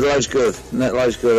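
ordinary talk, nothing marked

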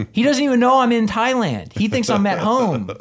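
high, loud, comic disbelief; incredulous exclamation. Real, not acted. Telling friends the boss has no idea, incredulous. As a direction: pitch it way up, amazed at the absurdity. Amazed at absurdity of the call.

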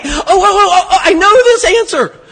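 high, loud, eager know-it-all whine; Horshack. Retold or imitated. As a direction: beg the teacher to call on you, voice up high. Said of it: "You're like Horshack on Welcome Back, Kotter".

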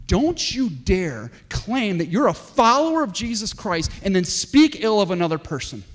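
John's stern rebuke. Acted. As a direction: drive the warning home at full voice. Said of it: "And John said".

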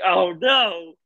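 a sudden high, loud "oh no" of dismay; reliving a sad movie moment, sharp dismay; retold dismay. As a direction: cry out as the scene hits you. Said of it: "I'm like".

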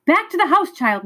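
sharp high yell of alarm; urgent high-pitched cry of warning. A narrator's reading. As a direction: shout him back to the house, fast. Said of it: "Missus Griffin yelled".

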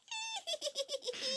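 high witch cackle; cartoon witch cackle. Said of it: "she laughs like that witch".

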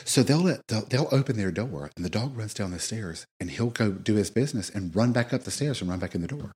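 hushed gossip, barely voiced; whispering. Real, not acted. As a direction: lean in and whisper the dirt. Gossiping about downstairs neighbor.

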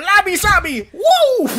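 high, loud laughing war whoop; wild battle cry. Retold or imitated. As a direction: swing the stool, whooping through laughter. Acting out Gwess swinging stool.

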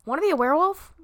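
loud, high-pitched pitch; devil's chirpy offer. Acted. Devil offering a dead soul another option, chirpy. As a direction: pipe up with a sudden offer.

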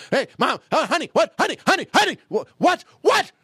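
high, rapid-fire alarm calls; frantic acted warning. Family warning the driving mother, frantic calling.

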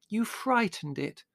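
slow, high-pitched accusation; accusing young woman. A narrator's reading. Margot blaming Larry for the donkey's fright, accusing. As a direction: blame him in a high voice.

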